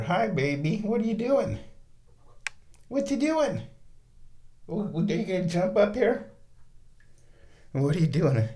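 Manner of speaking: high cooing voice for the kitten. Real, not acted. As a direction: coo at the cat. Baby talk to the cat.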